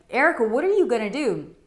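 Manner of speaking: fast, high, loud retold panic; friend freaking out. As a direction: imitate a buddy urgently demanding an answer. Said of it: "He was freaking out. He's like".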